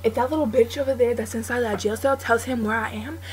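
ordinary talk

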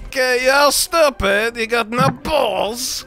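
mock Kazakh accent; Borat. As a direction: put on a comic foreign-visitor accent. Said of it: "Yo, Borat".

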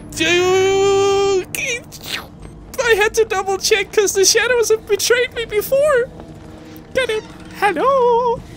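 very high, squeaky voice; high-pitched squeal. Real, not acted. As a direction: squeal it out after a scare. Spooked by own shadow in game.

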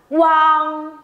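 QUANG as "wang", U run into ANG, high and level; joined U-ANG glide. Shown as a demonstration. Teacher drilling students, joined-up QUANG. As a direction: blend U into ANG in one held QUANG. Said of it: "Đọc liền cho cô nào".

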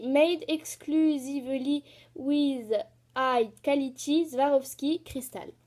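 slow, careful English read with a strong French accent. Real, not acted. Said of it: "Excusez-moi pour mon accent pourri". (anglais lu lentement avec un fort accent français)

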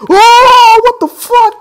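very high, very loud shriek; shocked scream. Real, not acted. Player who wasn't even prepared, shocked scream. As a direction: scream it in disbelief.

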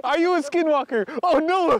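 high, loud mock panic; mock scared. Asking the animal if it's a skinwalker, mock fright. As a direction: pretend to be spooked.

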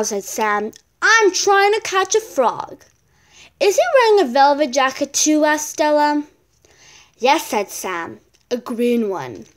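loud, lively read-aloud voice for a picture book; bright storybook reading; reading a story aloud. Acted. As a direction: read it out to the class with energy. Child reads story aloud.

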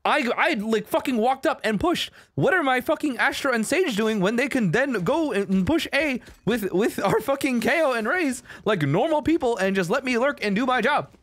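fed-up gamer's tirade; annoyed rant. Real, not acted. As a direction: rant in real frustration, pitch climbing and staying up. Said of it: "This is annoying".

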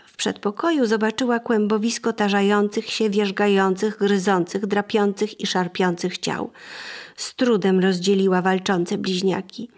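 plain reading voice

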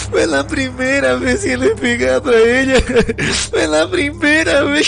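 high, crying, broken begging; sobbing plea. Acted. The inmate crying to his attackers, high and sobbing. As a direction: sob and beg for mercy.

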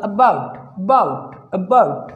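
"अबाउट" as a faint uh plus bout; very light schwa. Shown as a demonstration. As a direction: barely sound the first vowel of "अबाउट". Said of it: "बहुत ही हल्का सा अ".

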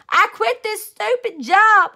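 loud, high, slow fed-up shout; child quitting in a huff; angry quitting. A narrator's reading. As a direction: slam it down, fed up. Angry outburst after the fall.

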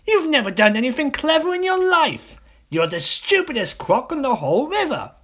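high, loud jeering voice; scornful little croc. Acted. Small crocodile insults the enormous one, high jeering. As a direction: sneer it from up high.

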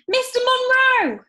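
high, loud slow call across the harbour; shouting. Acted. Jazz shouting across the harbour to Mister Munro. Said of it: "she shouted".

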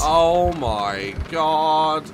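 stunned dismay. Real, not acted. Gamer overwhelmed by the battle, slow low stunned dismay. Drained reaction after battle scare.

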